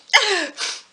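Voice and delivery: short high laugh after a sneeze; embarrassed laugh. Real, not acted. (petit rire gêné)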